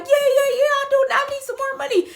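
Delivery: high, excited mock squeal; eager drunk agreement. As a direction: jump at the offer, high-pitched.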